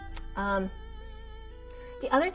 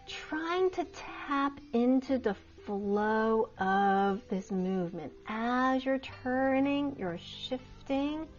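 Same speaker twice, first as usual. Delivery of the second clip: serene tai chi cues; 5 dB quieter than usual. Acted. Guiding flowing movement practice.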